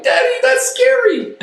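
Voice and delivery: put-on high, loud little child's voice; imitating scared toddler. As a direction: squeak it like a frightened three-year-old. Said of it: "My three-year-old ran away crying".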